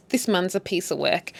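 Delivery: usual speaking voice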